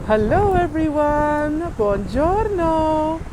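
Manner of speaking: high, slow sing-song hello; drawn-out diva greeting; campy drag queen. Acted. Sing-song theatrical greeting to viewers.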